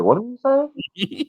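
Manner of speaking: high, slow, mock-stunned question; stunned disbelief. Son stunned by Ron's offer, incredulous.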